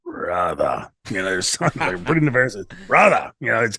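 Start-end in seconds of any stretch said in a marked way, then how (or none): 0.0-0.9 low, drawn-out "bruthah" with the R dropped; Kevin Sullivan. Retold or imitated. Guest imitating Kevin's catchword, low drawn-out impression. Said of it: "the way he says". 2.9-3.3 higher, louder, short "bruthah"; Kevin Sullivan. Retold or imitated. Guest showing another of Kevin's moods, louder high impression. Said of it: "the way he says".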